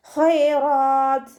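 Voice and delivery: slow, drawn-out melodic tajweed chant; Quran recitation. Shown as a demonstration. Teacher models melodic Quran recitation for students. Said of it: "ഉയർത്തിയിട്ട് ഉച്ചരിക്കുക".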